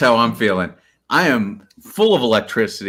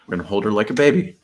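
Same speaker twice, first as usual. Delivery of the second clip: ordinary talk, nothing marked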